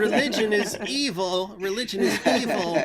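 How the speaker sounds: lampoon him as a stiff objector; hammed-up impression of a friend banning religion; mocking his friend. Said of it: "and he's like".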